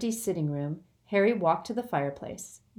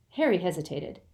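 ordinary delivery, nothing marked

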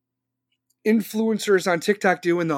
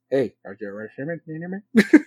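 lower, ending in a laugh, mock goofy greeting; mock face-cam greeting; 7 semitones lower, faster than usual. Mocking himself filming viewers, lower, laughing at the end. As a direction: play the silly selfie caller and break up laughing. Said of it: "I'd be like".

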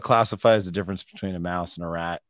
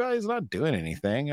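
shrugging reply; 7 semitones higher than usual. Retold or imitated. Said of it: "I'm like".

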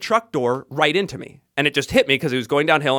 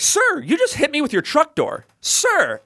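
high-pitched incredulous protest; indignant; 8 semitones higher than usual. Retold or imitated. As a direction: re-enact confronting someone in outrage. Re-enacting his outraged confrontation.

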